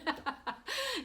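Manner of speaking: high burst of laughter. Real, not acted. Laughing at her own daughter's correction.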